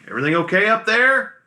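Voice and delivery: captain calling forward from the cabin. Acted. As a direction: shout it up to the front of the boat. Captain calls out to the crew.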